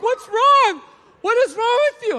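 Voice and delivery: worried wife. Retold or imitated. Wife asking her pacing husband, alarmed and high. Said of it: "she's like".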